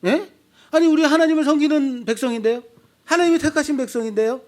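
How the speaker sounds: high, loud protesting people; indignant protest. Acted. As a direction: protest in shock as the wronged people. Israel protesting to God. (높고 크게 억울해하며 항의하는 백성)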